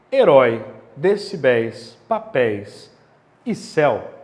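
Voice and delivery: "herói" punched loud on last syllable; slow, loud list of example words; example words stressed. Shown as a demonstration. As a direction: hit the final syllable of each word. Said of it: "teacher rereading example words".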